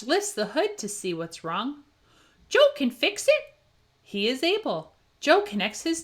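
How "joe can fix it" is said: much higher eager puppy voice; excited cheer. A narrator's reading. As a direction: cheer it like a proud pup. Pup cheering Joe on.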